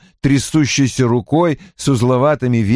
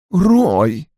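ordinary delivery, nothing marked

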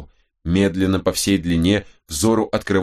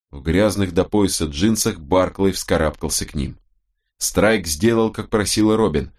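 ordinary delivery

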